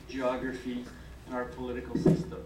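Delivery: usual speaking voice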